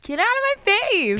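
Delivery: high-pitched mock indignation; mock outburst. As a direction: pitch leaps up in a play-fight protest. Mock fight over yelling.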